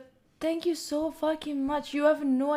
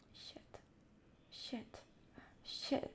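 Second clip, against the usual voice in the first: faint, voiceless repeated curse; stunned whispered swearing; 22 dB quieter, much slower than usual. Real, not acted. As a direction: whisper it to yourself in shock. Stunned by the total.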